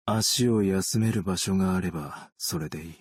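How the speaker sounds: ordinary delivery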